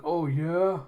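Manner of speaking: acted thug retort. Thug answers a threat, mock belligerent. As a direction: throw it back as a cocky dare.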